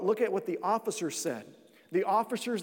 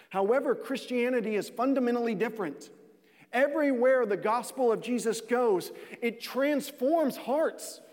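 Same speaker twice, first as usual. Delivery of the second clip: rising preaching; 5 semitones higher than usual. Acted. Preaching climax.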